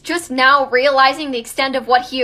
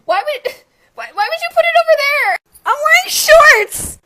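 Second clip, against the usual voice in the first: high, loud, half-laughing complaint; exasperated whine; 13 semitones higher, 8 dB louder than usual. Real, not acted. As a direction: wail in disbelief, dragging the last word. Complaining about misplaced package.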